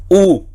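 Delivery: "U" as one pure "oo" vowel; single vowel sound. Shown as a demonstration. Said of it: "un solo sonido vocálico que es".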